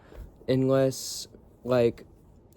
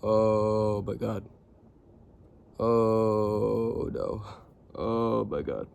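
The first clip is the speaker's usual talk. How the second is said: weary Bob impression; much slower than usual. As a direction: sigh it out like a tired dad overwhelmed. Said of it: "he'd be like".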